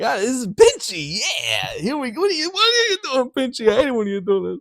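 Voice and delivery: a nervy bully scolding his claw; Diesel Ten voice. Retold or imitated. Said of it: "Neil Crone was just like".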